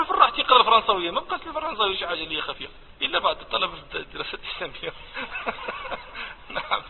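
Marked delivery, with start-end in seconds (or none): 5.1-6.5 high laugh. Real, not acted. Amused reaction to student.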